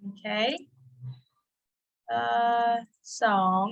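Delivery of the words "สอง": สอง with a clear rising tone. Shown as a demonstration. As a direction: let สอง climb at the end. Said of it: "Rising tone".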